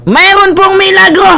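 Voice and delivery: high, excited mock proclamation; hyped proclamation. Vlogger announcing a miracle to viewers, hyped proclamation.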